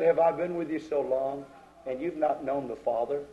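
low, soft and gentle; patiently ask, never pushing; calm. Retold or imitated. Jesus gently questions his disciples, low and soft. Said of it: "calmly and collectively say".

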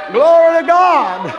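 usual speaking voice

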